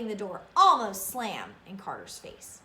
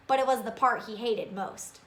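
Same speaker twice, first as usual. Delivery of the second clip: usual speaking voice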